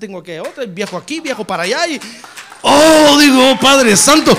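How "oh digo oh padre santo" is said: much louder, fervent exclamation; preacher crying out in awe; loud cry to God. Real, not acted. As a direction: cry out to the Father. Sermon exclamation.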